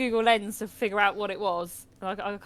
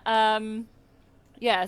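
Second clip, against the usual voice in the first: ordinary talk, nothing marked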